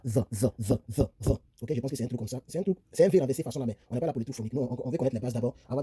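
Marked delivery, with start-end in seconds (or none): 0.0-1.3 THE with tongue placed between the teeth. Shown as a demonstration. Teacher shows students the TH sound, THE with tongue between teeth. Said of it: "mettre la langue entre les dents".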